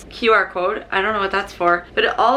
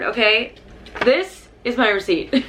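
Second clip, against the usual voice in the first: usual speaking voice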